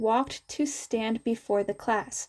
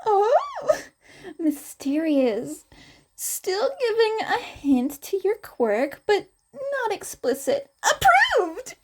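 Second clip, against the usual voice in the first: much higher, gushing and giggly; thrilled teacher; 10 semitones higher than usual. Acted. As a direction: squeal your approval like an excited teacher. Midnight voiced as delighted teacher.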